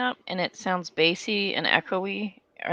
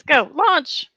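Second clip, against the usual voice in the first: high, loud burst of go; excited launch; 10 semitones higher, 6 dB louder than usual. Retold or imitated. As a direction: fire yourself out the door. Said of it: "I'm like".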